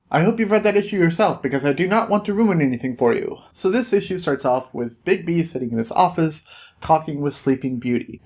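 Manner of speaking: ordinary talk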